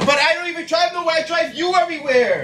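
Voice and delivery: fast, high-pitched protest with a laugh; high whiny protest. Real, not acted. Protesting while she throws him out.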